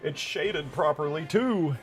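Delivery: high, drawn-out excited announcement; high-pitched, slow gleeful cheer; delighted exclamation. Real, not acted. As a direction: stretch it out, climbing to a high finish. Delighted the imported model looks right.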